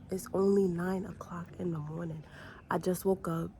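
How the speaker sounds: normal talking voice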